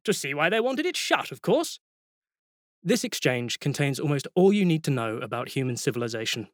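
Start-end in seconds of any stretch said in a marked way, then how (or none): 0.0-1.8 higher, brisk, matter-of-fact archchancellor; a hint of a bluff old wizard; Ridcully. A narrator's reading. Ridcully answers the lecturer.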